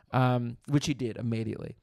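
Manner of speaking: usual speaking voice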